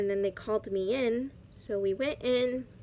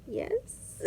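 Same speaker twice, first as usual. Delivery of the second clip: tiny high, soft squeak of an answer; small high-pitched, uncertain yes; hesitant yes; 14 semitones higher, 7 dB quieter than usual. Retold or imitated. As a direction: imitate a timid reply, pitched way up and hushed. Retold meek reply to doctor's look.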